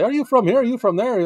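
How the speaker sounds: high-pitched imitation of curious people; mock questioner. People asking him where he is from, mock questioning.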